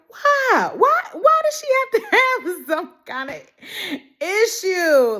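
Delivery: high, whining disbelief; exasperated wail. Real, not acted. Fan wailing at the writers over Annie's illness. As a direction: cry out at the unfair plot.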